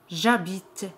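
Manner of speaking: "J'habite" run together with no E after the J; French "J'habite", J gliding straight into the A; elided vowel. Shown as a demonstration. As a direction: say "J'habite" as one word, silent H. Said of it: "se elimina la vocal".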